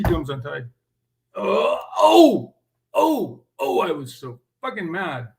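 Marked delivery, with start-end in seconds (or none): 1.4-3.4 loud, high exasperated oh oh oh; a loud, high cry of exasperation; frustrated groan. Real, not acted. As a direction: let the frustration burst out. Furious groan at untied shoe.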